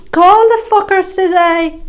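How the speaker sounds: high, loud, mocking challenge; scornful shout. Woman daring the bully to call police, shouting. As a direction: yell the dare right at him.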